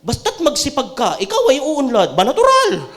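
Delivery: sarcastic, laughing read of a horoscope; mocking horoscope. Pastor mocking horoscope lines to the congregation, laughing.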